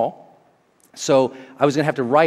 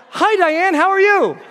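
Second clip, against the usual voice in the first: much higher and louder, gushing greeting; overeager greeting; 7 dB louder, slower than usual. Retold or imitated. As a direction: gush hello like you're thrilled. Overeager greeting, wrong name.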